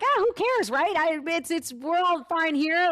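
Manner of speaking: high, breezy imitation of her careless self; mock carefree. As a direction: toss it off like nothing matters, voice way up. Mom mocks her old carefree self.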